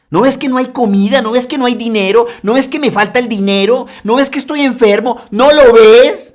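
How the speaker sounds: high, loud mocking questions; sarcastic outcry. Disciples sarcastically answering the Lord, loud high mockery. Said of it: "Sarcástica la respuesta".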